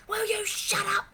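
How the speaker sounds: high, strained telling-off; mock exasperated mum. Owner telling the dog to shut up, high and strained. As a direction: snap it in a high voice. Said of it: "no point in saying".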